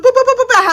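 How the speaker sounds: playful dialing noises; phone dial beeps. Retold or imitated. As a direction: mimic keypad tones.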